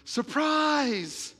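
high, loud, drawn-out cheer; party surprise shout. Retold or imitated. Everybody greets Bob at his birthday party, surprise shout. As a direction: shout it out like a whole crowd. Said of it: "Everybody goes".

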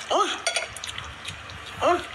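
normal talking voice

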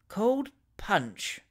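soft, sleepy murmur. A narrator's reading. Pickwick dozing off, murmuring his answer. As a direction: mumble it half asleep. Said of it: "murmured Mister Pickwick".

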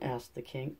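plain reading voice

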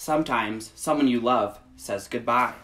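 gentle, measured picture-book read; reading to children. Acted. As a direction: read it slowly to little listeners. Reads picture book aloud.

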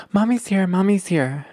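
lightly voiced mother reassuring her baby; soothing mommy. Mom picking up her crying baby, soothing.

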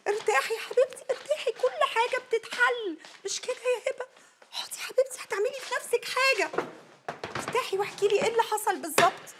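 soothing. Acted. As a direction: coax her down gently. Calming her angry friend.

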